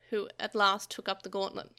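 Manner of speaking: ordinary delivery, nothing marked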